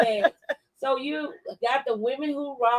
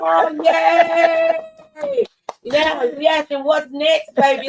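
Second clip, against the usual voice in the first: loud, high whoop of joy; excited cheer; 6 semitones higher, 7 dB louder, slower than usual. Real, not acted. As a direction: cheer your friend on loudly. Friend cheers the book news.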